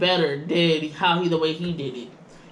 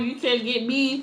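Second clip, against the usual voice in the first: mock dealer voice; 6 semitones higher, slower than usual. Mocking the car dealer.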